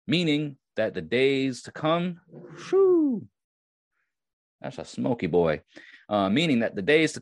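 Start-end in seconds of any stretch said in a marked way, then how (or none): 2.7-3.3 whew at the smoke. Real, not acted. Smoke from the incense.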